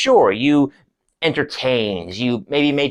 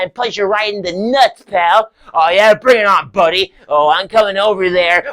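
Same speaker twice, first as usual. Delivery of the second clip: raised, louder, higher threatening voices; puffed-up tough talk, pitched up; heated squabble; 5 semitones higher, 4 dB louder than usual. Acted. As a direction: square up for a petty fight. Acted squabble between two audience members.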